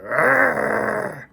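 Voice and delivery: loud high mock groan of pain; pained yell. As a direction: yell like fresh teeth were pulled. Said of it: "You'd probably be like".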